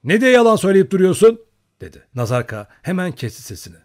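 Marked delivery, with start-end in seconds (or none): 0.0-1.4 high, loud rebuke from afar; shouting. A narrator's reading. Lookout scolding his fellow Cossack for lying, shouting. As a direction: yell down at a liar. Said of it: "Nazarka'ya bağıran Lukashka".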